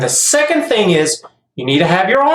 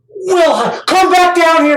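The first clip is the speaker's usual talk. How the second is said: mock calling; 10 semitones higher, slower than usual. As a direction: call the kid down like a playful dad. Calling a tossed kid back.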